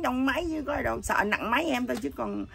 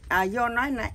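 ordinary delivery

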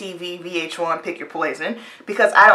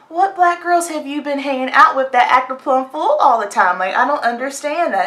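high-pitched exasperated questioning; real frustration; 7 semitones higher than usual. Real, not acted. Challenging people who call her different, exasperated. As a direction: demand an answer, voice raised in pitch. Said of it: "That's really, really frustrating to me".

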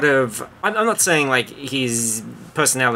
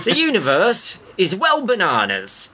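lightly imitated TV narrator, pitched well up; a hint of a marvelling presenter, high and lilting; Brian Cox; 8 semitones higher than usual. Comic rewrite of the novel's opening line, in a high, lightly put-on voice. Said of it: "Is that Brian Cox?".

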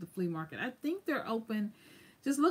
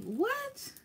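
much higher, startled what; shocked what. Retold or imitated. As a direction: react in disbelief at the price. Shocked at the price hike.

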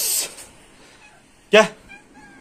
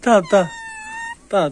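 normal talking voice

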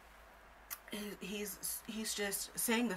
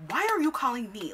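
higher, loud retort; exasperated demand; 6 semitones higher, 8 dB louder than usual. Retold or imitated. Retelling her reply to the caller, exasperated. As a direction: fire it back in disbelief.

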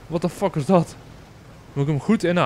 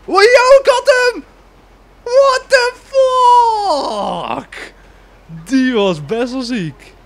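very high, loud joyful shriek; slow drawn-out shouted disbelief; ecstatic scream; 9 dB louder, much slower than usual. Real, not acted. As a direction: scream with triumph. Hits tractor, screams in joy.

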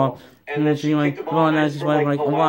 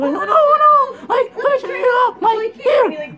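put-on high, loud, frantic kid's voice; panicked boy voice; 6 dB louder than usual. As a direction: squeal it like a scared little boy. Boy panics after hitting her.

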